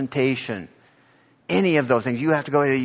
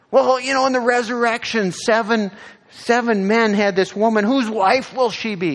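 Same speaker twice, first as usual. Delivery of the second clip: mock questioner; 10 semitones higher, 5 dB louder than usual. Voicing Sadducees' trick question.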